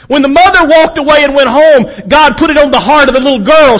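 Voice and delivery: loud, high preaching cadence; full-force preaching. Acted. Preacher pressing the congregation, loud and high. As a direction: preach it hard to the crowd.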